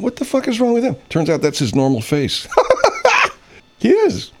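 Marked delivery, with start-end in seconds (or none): 0.0-1.0 high, startled outraged voice; incredulous guy. Retold or imitated. A stunned viewer asking about the goalie, high incredulous. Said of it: "I got the sound down". 2.5-3.3 a burst of high amused laughter. Real, not acted. The host cracking up at a crying-looking goalie, high laugh.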